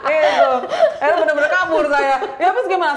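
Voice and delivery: high, loud, laughing voice; laughing admission. Real, not acted. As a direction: admit it through laughter. Guest laughs about her own escape.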